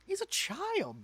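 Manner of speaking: high, loud disbelieving cry; squeaky shocked protest; shocked outburst. Real, not acted. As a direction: blurt it out in shock. Shocked outburst at game line.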